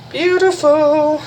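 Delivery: high, slow, stretched-out beautiful; drawn-out delighted praise. Real, not acted. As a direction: sing out the praise, long and high.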